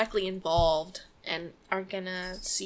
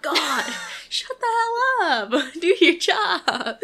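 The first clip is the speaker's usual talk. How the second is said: loud, laughing half-angry outburst; exasperated outburst; 7 semitones higher, 11 dB louder, slower than usual. Real, not acted. Reacting to the rude dispatcher, loud laughing outrage. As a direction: vent at the dispatcher through laughter. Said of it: "Holy shit".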